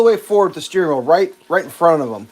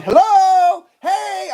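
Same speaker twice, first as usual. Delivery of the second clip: high, loud yell; 5 dB louder, much slower than usual. Retold or imitated. Yelling at the driver through the window, high and loud. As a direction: call out to get her attention. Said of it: "Now I start yelling".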